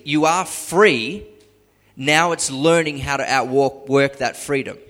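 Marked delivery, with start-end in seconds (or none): 0.0-1.3 "are" stretched out slow and loud; emphatic slow declaration that "you are free"; slow, loud stress on are. Acted. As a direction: land "you are free" slowly with weight on "are".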